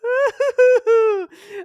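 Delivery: high, loud real laugh; cracking up hard at the memory; burst of laughter. Real, not acted. Retelling the bartender's reaction, bursting into a high loud laugh.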